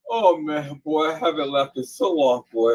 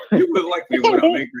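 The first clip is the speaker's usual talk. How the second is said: mock Hulk threat; 7 semitones higher, 4 dB louder, faster than usual. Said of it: "she said".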